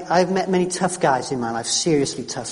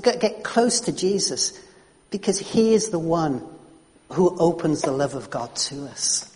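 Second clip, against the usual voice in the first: normal talking voice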